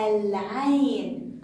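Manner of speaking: "العين" very slow, with a long ل; pronounced lam. Shown as a demonstration. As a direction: hold the L of العين, then a stretched ع. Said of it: "حرف العين من حروف اللام".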